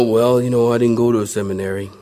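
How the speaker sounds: imitating his old sheepish self. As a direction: mumble it like you're ashamed of the answer. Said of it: "I used to sheepishly say".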